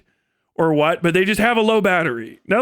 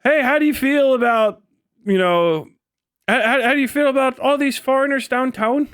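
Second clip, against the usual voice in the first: interviewer; 6 semitones higher than usual. Retold or imitated. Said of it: "this guy's fucking interviewing people".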